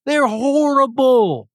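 high, whiny mock outrage; mock indignant Jonah. Preacher mimics Jonah complaining to God, high and whining. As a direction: squeal it like a petulant Jonah. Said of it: "Jonah's mad".

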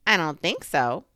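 loud put-on denial; disguised voice. A narrator's reading. Rabbit pretending to be someone else, disguised voice. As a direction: deny it a bit too firmly. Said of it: "in a different sort of voice".

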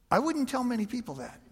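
lightly voiced friend's wry warning; dry roommate. Roommate advising the speaker, mild dry warning. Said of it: "He goes".

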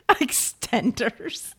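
slow, laughing question; laughing disbelief. Real, not acted. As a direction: chuckle the word out in disbelief. Amused disbelief at extenders.

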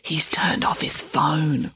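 slow, voiceless whisper; hushed secretive whisper; whispered reply. A narrator's reading. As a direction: whisper it confidentially, drawing out the words. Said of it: "Kate whispered in reply".

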